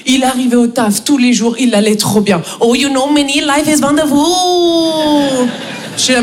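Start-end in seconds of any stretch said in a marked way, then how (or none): 2.6-5.5 drawn-out, singsong Indian English; hammed-up Indian accent, stretching the last word; blissful Indian colleague. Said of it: "Raj, un Indien. Il arrivait".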